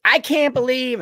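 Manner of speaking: high-pitched imitation of her outrage; mock indignant woman. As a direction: mimic her disbelief, pitched well up. Imitating her screaming complaint.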